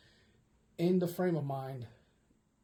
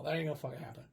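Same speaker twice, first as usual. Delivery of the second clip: normal talking voice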